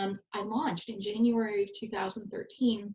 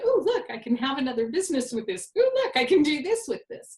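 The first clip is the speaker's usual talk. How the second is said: bright, eager "oh look" voice, rising higher; acting out a sudden excited discovery; mock excited realization; 5 semitones higher, 4 dB louder, faster than usual. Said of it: "it's like".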